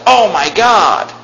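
drawn-out mock exclamation; imitating. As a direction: mimic someone swearing casually. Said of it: "cuss God's name".

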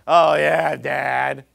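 sarcastic "Oh, yeah, Dad", loud and snide; eye-rolling teenager mocking dad; mocking teen. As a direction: sneer it at your father like a teen. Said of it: "You give the dirty look".

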